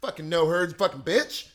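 loud phone gossip; gossiping mom. Retold or imitated. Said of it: "my mom's talking on the phone".

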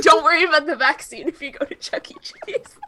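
high breathless laughing; cracking up nearly crying; laughing to tears. Real, not acted. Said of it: "You look like you're gonna start crying".

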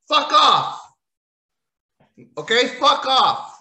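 loud, high yell of disgust; real fed-up outburst; angry shout. Real, not acted. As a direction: bark it at the screen. Ranting at the wrestlers.

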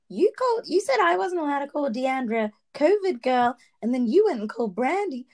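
a deliberately bad, high-pitched impersonation for laughs; mocking Cameron impersonation.

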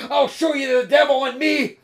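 loud, high fighting shout; roared threat; furious threat. A narrator's reading. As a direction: yell it mid-struggle, enraged. Intruder threatens doctor while fighting.

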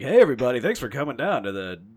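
imitated DJ greeting, loud opening; hammed-up radio welcome. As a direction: greet listeners like a put-on FM host. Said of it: "started out with me just being like".

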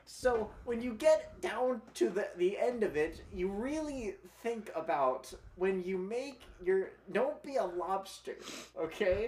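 high, slow, strained lecturing voice; Jordan Peterson impression. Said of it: "a Jordan Peterson impression".

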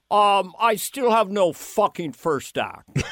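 slow, deadpan Lorne Michaels voice; Lorne impression. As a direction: drag it out like a weary producer. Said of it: "how I got Lorne".

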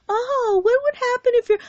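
very high-pitched mock worry; mocking imitation. Mimicking pastors who believe the lies, mocking.

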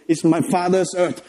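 ordinary talk, nothing marked

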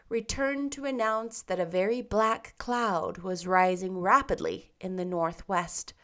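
ordinary delivery, nothing marked

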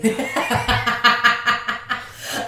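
bursts out laughing, high and airy; a breathy burst of laughter; loud laugh. Real, not acted. Friend's laugh at nipple talk.

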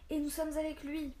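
éclat nettement plus haut et plus fort; s'avance et proclame son soutien; exclamation. A narrator's reading. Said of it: "s'exclama alors un homme". (le père d'Ariane s'exclame, plus haut et plus fort)

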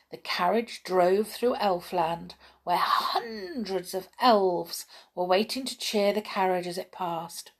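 usual speaking voice

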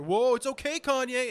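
high, loud paparazzo placating; mock placating. Paparazzo brushing off an angry star, mock placating. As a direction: imitate an oblivious pushy photographer.

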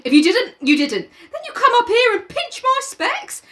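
put-on high boy accusing Jack; Piggy voice, accusing. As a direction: complain about the stolen glasses. Piggy voice held.

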